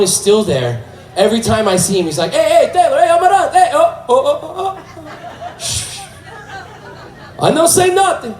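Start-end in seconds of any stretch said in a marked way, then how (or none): 2.3-5.6 very high, excited hollering; excited João. Acted. João spotting Taylor at the high school, high excited hollering. Acts out João calling to him. 5.6-6.0 long, hissed "shh"; exaggerated shush. João hushing Taylor about the secret, exaggerated shush. 7.4-8.3 very high, squeaky tease; conspiratorial João. Acted. João promising Taylor his secret is safe, high comic tease. João's secret-keeping catchphrase.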